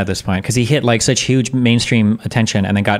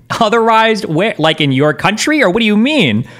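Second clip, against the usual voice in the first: mocking incredulity; 10 semitones higher, 4 dB louder than usual. Streamer mocking a chat comment with high incredulous scoffing. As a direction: scoff at the phrase in a high sneering voice.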